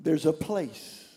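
hushed prayer invitation; gentle altar call. Real, not acted. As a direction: guide them quietly into prayer.

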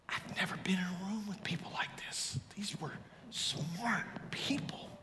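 soft, breath-only confiding reply; awed whisper. Retold or imitated. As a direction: whisper it as if overwhelmed by the company. Awed reply to wife at reunion.

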